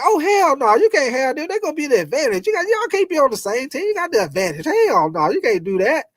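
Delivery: high, worked-up objection; protesting kid. Retold or imitated. Kid at pickup football objecting to the teams, high and worked up. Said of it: "They gonna be like".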